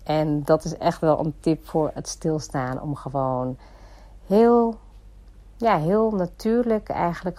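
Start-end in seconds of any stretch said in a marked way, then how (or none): none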